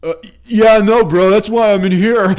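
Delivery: high, loud, laughing stammer; flustered drunk. Retold or imitated. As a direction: blurt it out, caught and embarrassed. Flustered answer to stranger.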